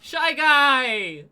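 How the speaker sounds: sudden hearty shout; loud announcement. Real, not acted. Moving on to the next character, loud announcement. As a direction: call out the name to change the subject.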